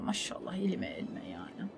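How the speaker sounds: unvoiced whisper of self-praise. Real, not acted. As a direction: breathe it to yourself. (kendi kendine fısıltıyla övünme)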